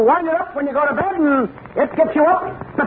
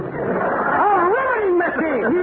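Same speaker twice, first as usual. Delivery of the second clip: comic whimper; 6 semitones higher, much slower than usual. Acted. Comic dismay.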